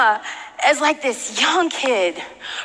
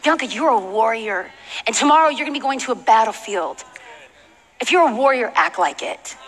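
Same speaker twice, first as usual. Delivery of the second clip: low, measured charge, dropping at the end; lightly voiced God giving a solemn order; 5 semitones lower than usual. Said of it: "God was speaking to me".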